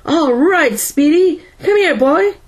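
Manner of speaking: high, coaxing yell; high calling voice. A narrator's reading. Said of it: "Powell yelled".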